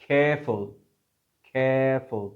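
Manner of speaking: careful said as kefʊl, no R sound; non-rhotic careful. Shown as a demonstration. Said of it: "आर के बाद ई आया है".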